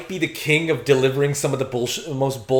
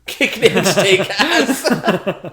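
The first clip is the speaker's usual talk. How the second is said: laughing; 5 semitones higher, 4 dB louder, much slower than usual. Acted. Laughing mock line while watching.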